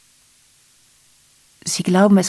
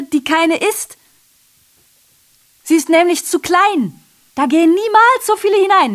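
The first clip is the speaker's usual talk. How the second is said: rising agitation; 9 semitones higher than usual. Acted. Narrator describing the cabin, climbing to agitated heights. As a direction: work yourself up to a pitch.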